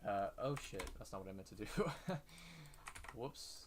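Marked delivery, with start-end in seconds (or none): 1.7-3.1 embarrassed laugh. Real, not acted. Laughs at own mistake.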